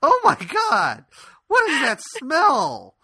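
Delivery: high, loud, drawn-out mock horror; hammed-up outrage at a stench; imitated appalled grandmother. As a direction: stretch every word in comic disgust. Grandmother reacts to awful smell.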